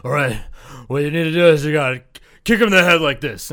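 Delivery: loud mock-instructor voice; Steven Seagal. Overweight fake instructor showing a student a kick, hammed-up. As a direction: play a blustering martial arts teacher, booming. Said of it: "gonna Steven Seagal you and be like".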